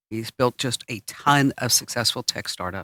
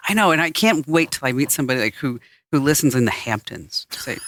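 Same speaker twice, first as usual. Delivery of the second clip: normal talking voice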